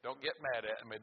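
normal talking voice

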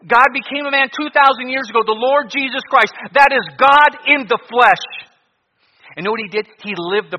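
high, loud fire-and-brimstone preaching; doomsday street preacher. Acted. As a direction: thunder out the warning of judgment.

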